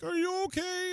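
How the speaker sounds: put-on very high, worried young woman's voice; high, breathless girl checking on a crash victim; panicked girl. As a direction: squeak it out like a frightened bystander. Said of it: "She's freaking out".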